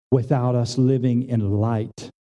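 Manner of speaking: ordinary talk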